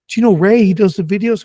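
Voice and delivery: eager nurse at surgery, high and bright; chirpy nurse. Retold or imitated. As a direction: play the excited nurse recognizing a name. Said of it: "And she goes".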